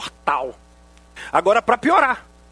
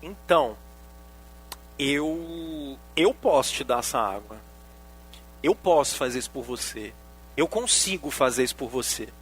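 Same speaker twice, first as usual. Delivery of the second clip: gentle Jesus; 6 semitones lower, 5 dB quieter than usual. Retold or imitated. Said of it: "Jesus olhou pra ela e falou".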